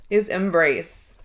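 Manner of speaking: ordinary talk, nothing marked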